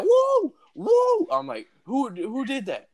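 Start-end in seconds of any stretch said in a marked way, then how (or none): 0.0-1.3 high-pitched, loud whooping cry; sudden high mock yell; retold yell. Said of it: "And I yell". 1.8-2.9 high, stammered startled question; retold startled yell. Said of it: "And I yell, I'm like".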